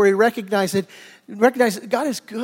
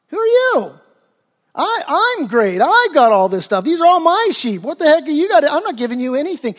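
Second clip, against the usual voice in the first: much higher, loud, scornful brush-off; pitched-up, indignant Nabal dismissing them; arrogant rich man; 6 semitones higher, 3 dB louder than usual. Acted. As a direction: sneer at David's men from on high. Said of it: "Nabal basically says".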